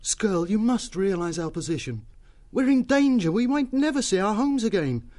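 put-on sincere, urgent young plea; earnest pleading. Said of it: "spoke earnestly to the newt".

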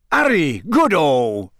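hearty, falling exclamations; happy Bagman. A narrator's reading. Bagman welcoming Harry into the tent, happy. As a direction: greet him loudly and happily. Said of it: "said Bagman happily".

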